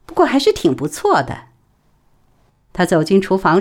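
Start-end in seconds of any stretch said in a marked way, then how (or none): 0.1-1.5 bright, pleased, higher pitch; cheerful. Acted. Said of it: "高高兴兴地说出声儿".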